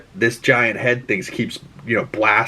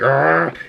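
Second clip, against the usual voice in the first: imitated angry cry, pitched up; angry growl; 4 semitones higher than usual. Rogue flying at the thing, imitated angry growl. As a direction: growl like her taking off in fury. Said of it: "she's, like, pissed".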